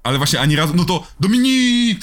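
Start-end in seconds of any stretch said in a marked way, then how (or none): 1.2-2.0 high, drawn-out name call; mock calling out. Shown as a demonstration. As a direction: stretch the last syllable up high. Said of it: "to by było już lepiej".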